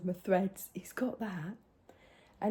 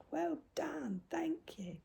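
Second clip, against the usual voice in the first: hushed, higher, tender thanks; soft praise to grandson; 7 semitones higher, 10 dB quieter, slower than usual. Retold or imitated. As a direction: whisper thanks to the proud boy.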